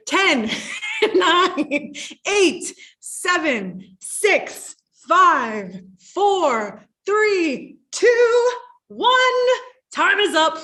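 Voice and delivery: loud, climbing playful countdown with laughs; excited countdown. Real, not acted. Playful live countdown for viewers.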